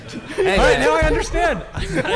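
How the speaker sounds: words squeezed out through helpless laughs; laughing. Real, not acted. Cracking up at the Con Air pick, laughing hard.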